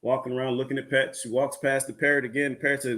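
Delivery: ordinary delivery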